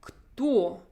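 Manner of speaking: Кто with rounded lips; Russian кто. Shown as a demonstration. Teacher models Кто for students, lips protruded. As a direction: say Кто clearly, lips pushed out. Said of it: "Repeat after me right now".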